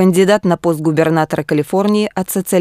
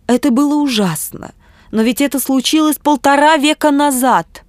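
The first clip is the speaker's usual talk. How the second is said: higher-pitched, mildly distressed objection; pained protest; 8 semitones higher than usual. A narrator's reading. Bishop interrupts Ernest's account of factory horrors, mildly pained. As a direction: plead it with a touch of pain, pitched up. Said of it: "с выражением боли на лице".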